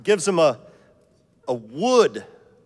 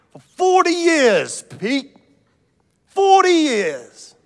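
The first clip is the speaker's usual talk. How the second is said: "forty" held long, very high and loud, falling; stress on forty; 12 semitones higher, 7 dB louder, slower than usual. Acted. Cabbie boasting to Saint Peter, hammering "forty". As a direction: lean hard and slow on "forty".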